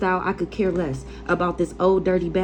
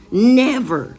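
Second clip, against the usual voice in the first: loud, drawn-out never; emphatic retold never; 5 semitones higher, 8 dB louder than usual. Telling the boyfriend off with a loud firm never. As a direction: slam the word down as a final answer. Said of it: "And I'm like".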